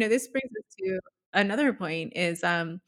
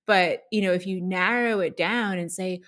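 ordinary delivery

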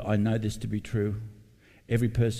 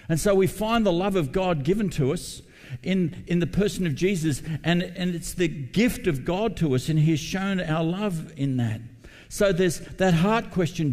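higher and louder, fervent pulpit delivery; preaching at full force; 8 semitones higher, 4 dB louder than usual. Acted. Pastor urging the congregation to love and serve, full-force preaching. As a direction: preach it out to the whole church with rising fervour.

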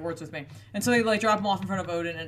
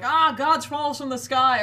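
loud, slow and high, like a warrior charging; warrior battle cry; 6 semitones higher, 7 dB louder than usual. Retold or imitated. Warrior woman roaring at the Vikings, loud battle cry. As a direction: call it out slowly across the battlefield. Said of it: "she's just screaming".

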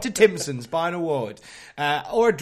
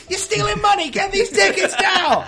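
laughing shout; 12 dB louder than usual. Real, not acted. Mock outrage at unpaid show.

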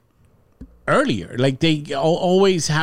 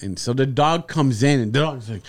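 ordinary delivery

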